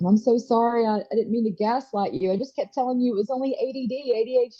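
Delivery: lightly voiced young son, high and pleading; son's apology. Son Noah apologizing, voiced by mom.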